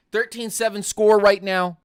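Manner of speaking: ordinary talk, nothing marked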